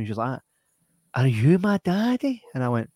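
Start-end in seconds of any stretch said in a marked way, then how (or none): 1.1-2.4 higher, slow mock little-girl voice; imitating little girl. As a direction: put on a wee lassie's questioning voice. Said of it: "a wee lassie came up to me".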